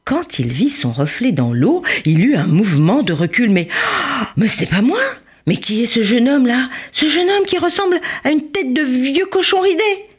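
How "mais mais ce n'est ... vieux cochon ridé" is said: high outraged mock wail; storybook howl of horror; horrified cry. A narrator's reading. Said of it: "Il hurlait".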